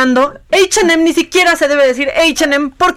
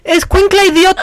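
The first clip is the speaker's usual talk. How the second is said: higher-pitched exasperated shout; indignant outburst; 6 semitones higher, 3 dB louder than usual. Real, not acted. Host berating the girl in the clip, indignant shout. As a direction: scold her loudly, pitched up.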